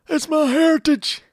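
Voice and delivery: high, loud mock protest; mock indignant. A heritage defender protesting to critics, mock indignant.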